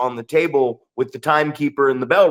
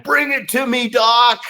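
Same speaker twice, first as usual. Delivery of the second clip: high-pitched shout across the ring; re-enacted yell to a colleague; retold yell; 9 semitones higher than usual. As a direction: call out urgently over a distance. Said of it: "I'm yelling at him".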